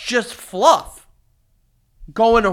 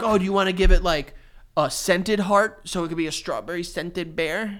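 usual speaking voice

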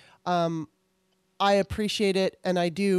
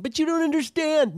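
mock protest, much higher and louder; whiny objector; 9 semitones higher, 4 dB louder than usual. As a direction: mimic a complaining voter. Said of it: "I can just hear people now saying".

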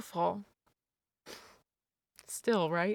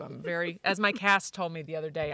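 ordinary talk

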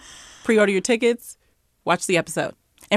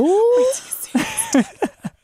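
excited exclaim; 14 semitones higher, much slower than usual. Shown as a demonstration. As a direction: burst out, way up and drawn out. Loud version after whisper.

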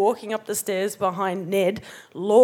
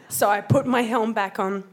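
ordinary talk

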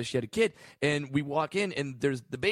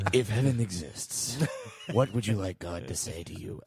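Lipton; 6 semitones lower, 3 dB quieter than usual. Acted. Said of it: "Fuck you, Lipton".

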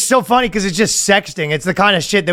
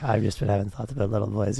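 hushed confession; 7 semitones lower, 10 dB quieter than usual. Acted. Acts penitent in confessional.

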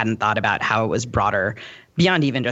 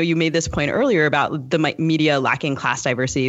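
ordinary delivery

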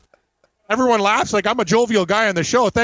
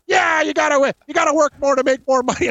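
mock mom yell; 7 semitones higher than usual. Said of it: "And then she's like".

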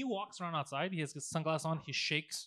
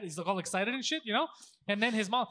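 usual speaking voice

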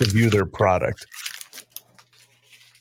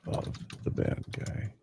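whispering to self; 13 dB quieter than usual. Real, not acted. Typing while repeating dictation.

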